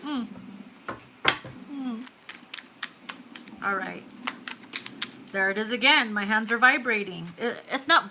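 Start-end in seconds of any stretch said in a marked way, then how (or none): none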